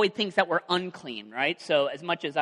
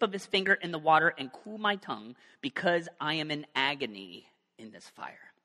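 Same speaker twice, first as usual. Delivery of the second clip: dramatic Bible reading, louder at the start; scripture reading; 4 dB louder than usual. Acted. Preacher reads Luke aloud to the congregation, dramatic reading. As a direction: read the passage with weight.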